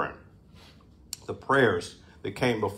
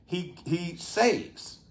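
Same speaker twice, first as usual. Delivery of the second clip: slow, high, emphatic pulpit delivery; forceful preaching; 5 semitones higher, 4 dB louder than usual. Acted. Preacher driving home judgment to congregation, slow and forceful. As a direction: preach it out, driving each phrase.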